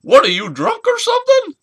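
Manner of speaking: loud, very high squawking impression; Paul Bearer. Manager teasing a fan at photo time, high loud squawk. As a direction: fire it back in a piercing falsetto. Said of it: "that Paul Bearer look".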